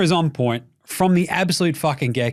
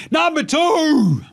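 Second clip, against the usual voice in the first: slow, high announcer call; 13 semitones higher, 4 dB louder, much slower than usual. Acted. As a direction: call it out like a show host. Announcing second question.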